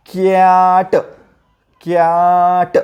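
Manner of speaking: कॅट with a very long drawn vowel; phonics blending. Shown as a demonstration. Teacher showing how to read it, कॅट slowly blended. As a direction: stretch कॅट out, then release ट. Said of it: "आता आपण इथं read कसं करणार?".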